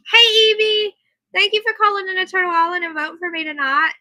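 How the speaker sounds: high, loud, bubbly hello to a friend; excited greeting. Real, not acted. Greeting and thanking an arriving listener.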